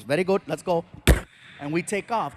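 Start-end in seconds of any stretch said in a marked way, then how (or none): 0.0-0.8 mock Indian accent, easy and cheerful; an unbothered Indian cabbie agreeing; Indian cab driver. Driver voiced, timbre shifted.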